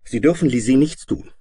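put-on high, loud boyish protest; firm boy. A narrator's reading. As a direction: stand up to the monster firmly. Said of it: "sagte Jim fest".

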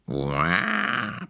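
a short whiny bear-cub roar. A narrator's reading. The cub protesting to his mother, a small roar. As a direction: roar it like a cross little bear. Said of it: "brüllte der kleine Brüllbär".

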